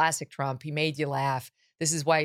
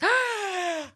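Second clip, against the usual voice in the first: mock sob gasp.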